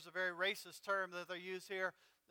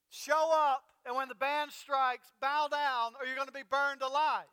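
loud herald's cry, higher and louder; bold proclamation; 4 semitones higher, 8 dB louder than usual. Retold or imitated. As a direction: proclaim it like a royal herald to the crowd. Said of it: "announced and proclaimed boldly".

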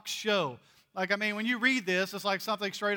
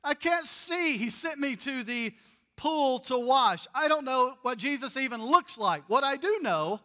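high, loud exasperated protest; healed man protesting; 5 semitones higher, 4 dB louder than usual. Retold or imitated. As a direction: throw up your hands, you were blind. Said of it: "his response is".

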